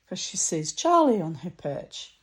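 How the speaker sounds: ordinary delivery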